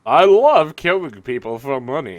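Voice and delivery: loud, hammy Australian sniper; Australian sniper impression. Player introduces his psychopath killer, hammy Australian sniper. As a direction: boast loudly in a mock Australian accent. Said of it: "that's my Team Fortress Two sniper".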